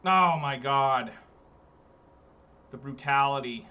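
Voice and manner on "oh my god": much louder, drawn out slowly; floored by the damage; stunned oh my god. Real, not acted. Takes massive damage.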